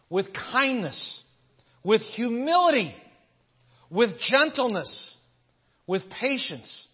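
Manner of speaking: slow, weighty reading with pauses; scripture reading. Acted. Preacher reading Colossians to the congregation, slow and solemn. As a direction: lay each virtue down slowly. Reads scripture slowly with force.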